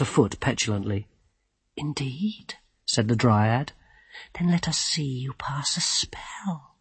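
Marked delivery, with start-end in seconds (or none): 1.8-2.6 soft sceptical murmur; quiet, doubting dryad; hushed dryad. A narrator's reading. Druelai hushed. 4.4-6.7 slow taunting whisper; whispering dryad. A narrator's reading. Druelai whisper.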